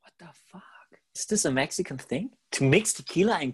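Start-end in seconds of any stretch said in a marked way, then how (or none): 0.0-2.3 hushed, breathy whisper. Real, not acted. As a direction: whisper it like a secret. Stunned aside about tequila mix.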